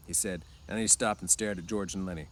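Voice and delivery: ordinary delivery, nothing marked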